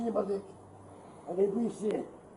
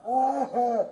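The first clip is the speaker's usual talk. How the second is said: loud gasps for air; gasping; 3 dB louder than usual. Real, not acted. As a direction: gasp for breath under pressure. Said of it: "I can't breathe".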